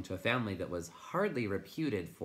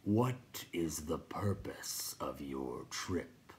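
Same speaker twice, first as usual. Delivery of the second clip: very slow, whispery creature; crackling; 3 semitones lower, much slower than usual. A narrator's reading. Rock Chewer questions Blub's trip, slow crackle. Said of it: "Pjorn Krachark crackled".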